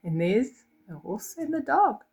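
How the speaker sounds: ordinary delivery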